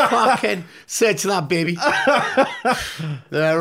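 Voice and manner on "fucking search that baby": cheeky laughing challenge; laughing taunt. Retold or imitated. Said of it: "I went".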